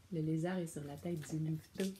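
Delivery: plain reading voice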